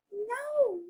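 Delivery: very high, squeaky little pig; high-pitched piggy voice. Acted. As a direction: squeal a refusal as a tiny pig. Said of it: "the two little pigs both say".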